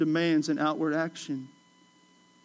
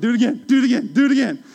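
louder, higher eager taunting; egging him on loudly; mock eager shout; 5 semitones higher, 8 dB louder, much faster than usual. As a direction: [name] taunting John to hit again, loud and eager. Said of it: "I said".

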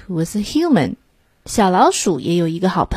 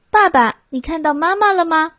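put-on child voice; 9 semitones higher than usual. A narrator's reading. Child asking father.

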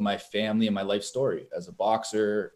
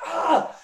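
mock yelp of pain. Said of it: "I'm like".

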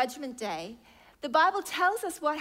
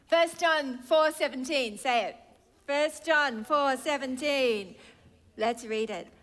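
ordinary delivery

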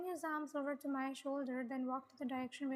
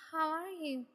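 usual speaking voice

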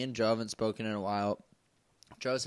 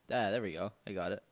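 usual speaking voice

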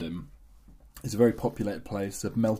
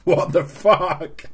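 drawn-out, louder "what on earth" reaction; mock shocked disbelief; 4 semitones higher, 8 dB louder, much slower than usual. Partner at the sink hearing the video, mock appalled. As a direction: stretch it out, aghast, for laughs.

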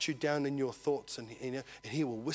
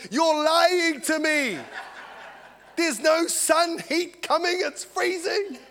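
high, loud, stretched-out comic protest; mock outraged yell; 13 semitones higher, 7 dB louder, much slower than usual. Man on freezing balcony scolding the sun, mock outrage. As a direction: yell it drawn out in hammed-up disbelief.

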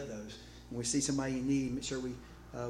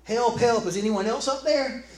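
high, loud holler up the cliff; comically desperate cry for help; yelling for help; 8 semitones higher, 9 dB louder than usual. Acted. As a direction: shout past the first rescuer for someone else. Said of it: "finally Jack yelled".

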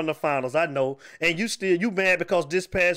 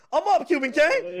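higher, slower, crowing over his rival; gloating shout; 9 semitones higher, slower than usual. Real, not acted. Host gloating to rival caller about his win, shouting.

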